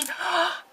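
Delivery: 놀라서 짧게 숨을 헉 들이쉼; sharp surprised gasp. Real, not acted. Remembering something funny.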